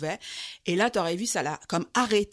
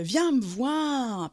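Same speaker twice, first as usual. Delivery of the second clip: loud, high, very slow come-here call; drunk calling out; 6 semitones higher, 5 dB louder, much slower than usual. Retold or imitated. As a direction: drag out the call to a stranger across the platform. Drunk man calling her over.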